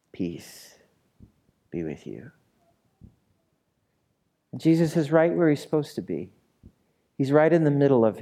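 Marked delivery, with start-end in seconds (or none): 0.1-2.3 slow, soft breathy peace; whispered blessing. Acted. Pastor voicing Jesus whispering peace to the disobedient. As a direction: breathe the words like a benediction.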